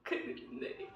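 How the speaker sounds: very soft, voiceless despair; broken whisper. Acted. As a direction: let it collapse into a tearful whisper. Same vow repeated, nearly voiceless.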